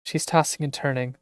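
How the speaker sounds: ordinary talk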